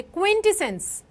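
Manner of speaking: क्विंटेसेंस said slowly and clearly, syllable by syllable; slow, clear teacher's model of क्विंटेसेंस; careful pronunciation. Shown as a demonstration. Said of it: "इसको हम कैसे बोलेंगे?".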